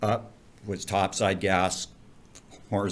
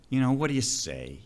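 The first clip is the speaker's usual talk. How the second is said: ordinary talk, nothing marked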